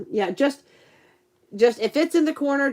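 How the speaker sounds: usual speaking voice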